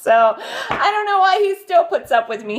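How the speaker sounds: high, loud words through a laugh. Real, not acted. Laughing while telling the viewers about Sean. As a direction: giggle the whole line out.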